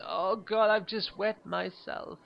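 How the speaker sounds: high, loud, slow mock rapture; gushing gallery woman. Woman reacting to a painting, mock-swooning. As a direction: overdo an art lover's gasp.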